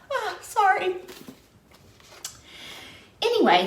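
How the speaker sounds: ordinary talk, nothing marked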